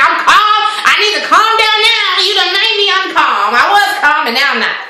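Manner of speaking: high-pitched outburst losing all calm; imagined meltdown, voice jumping way up; mock snapping. Recapper imagines snapping at being told calm down, high and indignant. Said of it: "like, snap".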